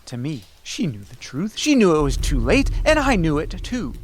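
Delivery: ordinary delivery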